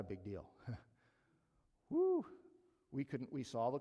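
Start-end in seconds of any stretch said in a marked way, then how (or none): 1.9-2.2 a high laughing "woo" of excitement; laughing whoop. Retold or imitated. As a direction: whoop with delight, half laughing.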